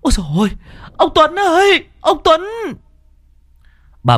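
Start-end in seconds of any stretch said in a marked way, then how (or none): 0.0-2.4 high, loud, fast panicked cries; frantic calling. A narrator's reading. A mother shakes her daughter and screams for her husband. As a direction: scream for your husband in terror.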